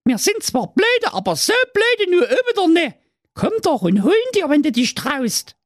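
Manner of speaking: put-on very high, taunting kid voice; cocky little kid; cheeky goat kids. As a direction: tease the villain.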